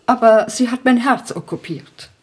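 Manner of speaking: ordinary talk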